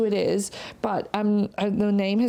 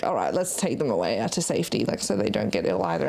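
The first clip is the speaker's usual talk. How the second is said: much lower, grudging mock husband voice; gruff husband impression; 12 semitones lower than usual. Friend imitating Mister Palmer agreeing to leave, low and grudging. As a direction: drop into a deep reluctant grumble. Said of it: "He's like".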